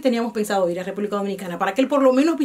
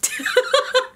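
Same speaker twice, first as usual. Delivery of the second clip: high laugh; 14 semitones higher than usual. Real, not acted. Laughing at husband's remark.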